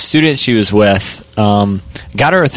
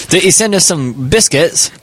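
high, hammed-up British accent; a bad put-on British accent; appalling British accent; 7 semitones higher than usual. Mocking the London snack senders in a bad British accent. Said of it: "That was appalling".

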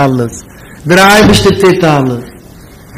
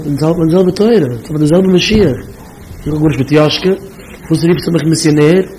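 normal talking voice

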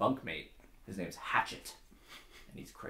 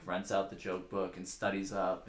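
ordinary delivery

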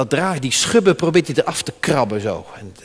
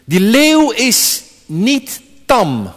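solemn slow; 4 semitones higher, 4 dB louder, much slower than usual. Real, not acted. Solemn preached statement.

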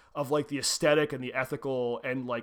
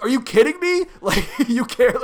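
much higher, much louder, laughing disbelief; mock incredulous; 9 semitones higher, 9 dB louder than usual. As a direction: scoff at the devil through a laugh. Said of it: "and Adrian's like".

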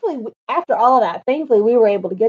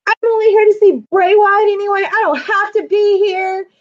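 high, louder mock tirade; retold indignant rant; 8 semitones higher, 4 dB louder than usual. Friend holding up tickets at staff, indignant. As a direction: imitate a worked-up fan, pitched up.

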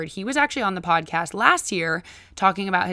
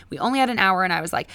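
usual speaking voice

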